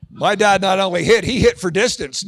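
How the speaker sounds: normal talking voice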